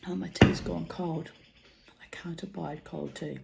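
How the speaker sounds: hushed whisper to herself; a breathy whispered grumble about cold tea; whispered aside. Real, not acted.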